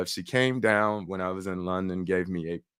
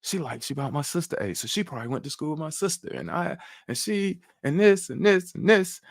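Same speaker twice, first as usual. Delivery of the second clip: racing inner thoughts; 8 semitones higher than usual. Retold or imitated. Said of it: "I was like".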